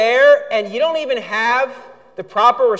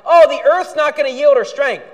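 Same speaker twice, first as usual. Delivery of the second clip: whiny mock complaint; 6 semitones higher, 7 dB louder than usual. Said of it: "They're so concerned about this life".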